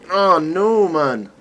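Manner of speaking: sudden high cry of dismay. Real, not acted. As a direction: yelp it out as the life goes. Losing a life in the game.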